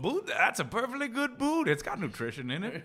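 lightly voiced prospector, mock offended; gruff mountain man. Prospector defending boot stew.

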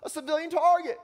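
high, loud moral outrage; protesting student. Acted. Student objects to bombing Libya, loud outraged protest. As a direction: argue hotly at the top of your pitch. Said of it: "students on this side of the room are saying".

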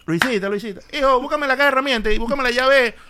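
tío que pide herramientas; uncle voice. Retold or imitated. Uncle quoted in anecdote.

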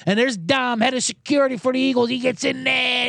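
usual speaking voice

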